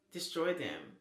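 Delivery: ordinary delivery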